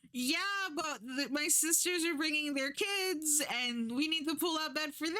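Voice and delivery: hammed-up politeness hiding the push to leave; fake-polite hosts. Hosts excuse.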